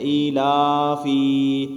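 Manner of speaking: ایلافی with the final sound wrongly stretched and sung; wrong long ending. Shown as a demonstration. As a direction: imitate children dragging the last letter. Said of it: "لمبا کر دیتے ہیں". (بچوں کی طرح آخری حرف کھینچ کر)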